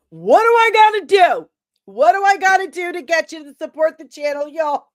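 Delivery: high, loud mock despair; begging the chat to support the channel; exasperated plea. Real, not acted. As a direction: throw up your hands and beg. Pleading with viewers to join.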